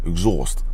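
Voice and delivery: Exhaust said with a New York twang. Shown as a demonstration. Noting his own accent, Exhaust in a New York sound. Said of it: "See how I talk like that?".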